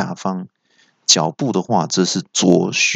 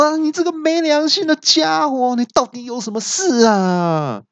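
very high, wailing villain outburst; exasperated yell. A narrator's reading. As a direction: cry out high, stretching the last word. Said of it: "那恶怪听了大叫说". (抓狂尖声大叫)

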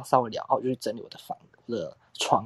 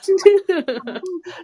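sudden high giggle breaking out; real amused laugh; high burst of laughter; 7 dB louder than usual. Real, not acted. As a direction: laugh out loud, pitched way up. Laughing at dad's remark.

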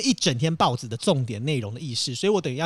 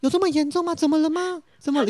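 higher, concerned lady officer; female officer voice; 11 semitones higher than usual. Retold or imitated. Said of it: "因为她是一个女，女，女长官". (模仿女长官，声音偏高、带着关心)

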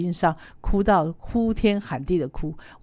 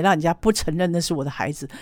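ordinary talk

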